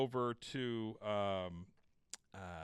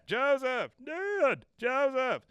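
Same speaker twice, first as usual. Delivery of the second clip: calling out; 13 semitones higher, 7 dB louder, much slower than usual. Retold or imitated. Father and son calling out.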